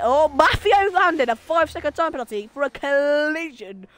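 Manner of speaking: excited race commentator; play-by-play call. Acted. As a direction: announce the penalty like live TV.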